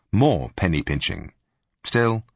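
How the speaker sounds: plain reading voice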